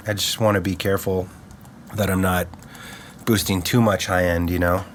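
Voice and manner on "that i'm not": ordinary talk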